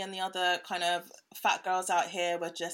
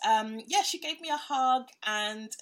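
ordinary delivery

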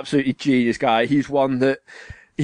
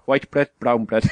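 lower-pitched imitation of South African mate; South African accent; 5 semitones lower than usual. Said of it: "the South African lad".

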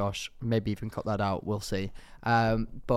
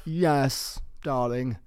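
lightly voiced posh darling; a touch of posh celebrity drawn over the words; Charlotte Tilbury; 2 semitones higher, much slower than usual. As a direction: gently send up a posh hostess. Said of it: "that's an impression of her".

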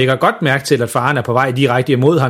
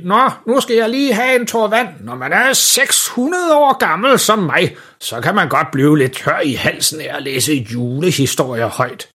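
high, put-on old Santa voice; julemand; 5 semitones higher than usual. A narrator's reading. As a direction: play a very old Santa, pitched up. Julemanden taler i baggrunden. (julemandens gamle stemme)

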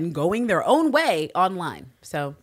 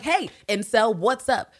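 higher, louder taunting challenge; cocky call-out; 7 semitones higher, 5 dB louder, slower than usual. Retold or imitated. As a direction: call them out, goading. Taunting call to incels in retold scene.

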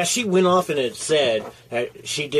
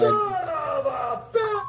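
outraged high shout; much slower than usual. Real, not acted. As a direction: yell it out, long.

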